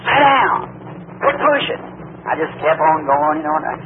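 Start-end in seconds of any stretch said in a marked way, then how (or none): 0.0-1.8 high-pitched crowd yell; imitated hollering. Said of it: "everybody hollered".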